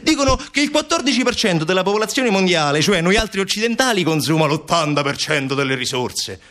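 high, impassioned outburst; indignant Montalbano. Acted. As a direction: plead urgently against the world's injustice.

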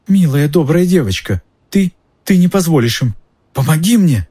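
high, wheedling plea for mercy; desperate pleading. Acted. Pettigrew begs Hermione for help, desperate pleading. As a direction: beg in a high, panicky voice.

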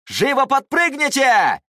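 urgent high slow shout. A narrator's reading. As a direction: bellow the order at full force. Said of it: "крикнул ученикам".

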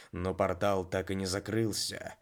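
ordinary delivery

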